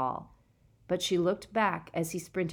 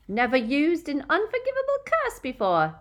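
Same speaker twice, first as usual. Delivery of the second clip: loud, high sneering yell; 9 semitones higher, 5 dB louder than usual. A narrator's reading. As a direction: yell it as a taunt. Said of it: "she yelled".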